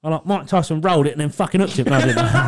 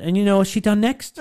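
lightly voiced eager shop worker; higher, eager gossip; gossiping shop bloke, pitched up; 7 semitones higher than usual. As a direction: kebab man telling tales, higher and eager. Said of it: "he went".

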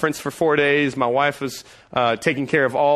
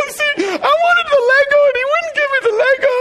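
much higher, louder, whiny sob-talk; mock crying kid; 6 dB louder than usual. Son tearfully complaining to dad, high and crying. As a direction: wail like a wronged child. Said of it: "So he starts crying".

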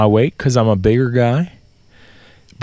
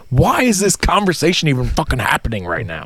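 exasperated rant, slightly raised pitch; retold annoyance, a bit higher than usual; annoyed retort; 4 semitones higher than usual. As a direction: let the irritation spill out, mock exasperated. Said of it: "I'm getting annoyed".

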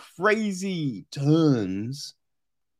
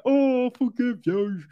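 mock forgiveness; 6 semitones higher than usual. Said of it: "it's just like".

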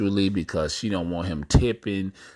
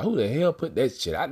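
ordinary delivery

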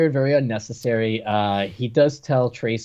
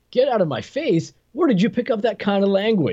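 quoting the hero's line, higher and theatrical; mock cop one-liner; 9 semitones higher than usual. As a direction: toss back "Get out of my face?" with cheesy disbelief. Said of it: "and Miles says".

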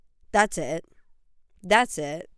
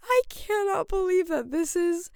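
much higher, breathless disbelief; stunned disbelief; 11 semitones higher than usual. Real, not acted. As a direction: say it like you just saw the news. Reacting to baby announcement.

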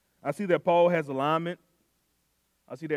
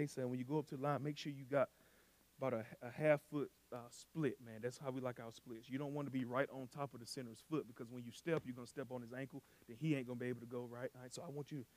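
soft, fast, quiet explanation; calm coaching; 5 semitones lower, 14 dB quieter than usual. Retold or imitated. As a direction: pull the kid aside and talk low.

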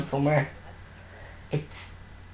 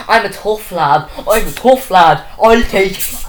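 mock tough; 4 semitones higher, 6 dB louder than usual. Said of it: "never see me cry".

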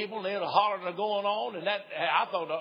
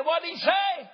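put-on high, loud squawk of a deaf old woman; shrill old wife; 11 semitones higher, 5 dB louder, slower than usual. Deaf wife asks again.

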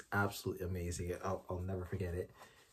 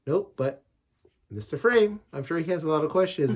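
teasing wife imitation; 9 semitones higher, 11 dB louder than usual. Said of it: "Telly would be like".

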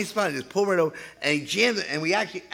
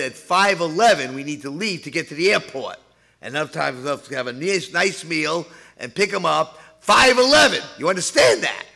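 louder, higher stern husband; impatient husband laying down the law; husband ordering wife; 3 semitones higher, 6 dB louder than usual. Retold or imitated. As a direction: bark the deadline at her like a bossy husband.

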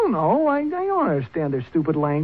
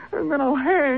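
higher panicked cry; panicked whine; 10 semitones higher than usual. Acted. Son panicking to his father about hanging. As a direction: dread of the gallows.

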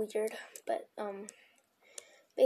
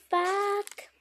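high frustrated curse; blurted curse; 8 semitones higher, 5 dB louder than usual. Real, not acted. Mistake curse.

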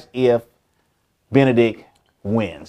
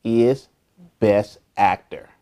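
ordinary delivery

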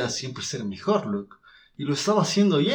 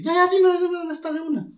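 very high, louder eager squeak; eager squeaky voice; 5 dB louder than usual. Retold or imitated. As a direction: beg for the address in a tiny high voice. (voz aguda y ansiosa)

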